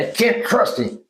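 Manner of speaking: punchy angry outburst, cut short. Shown as a demonstration. Therapist demonstrating the phrase in another tone, sharply angry. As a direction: hit the words hard, angrily.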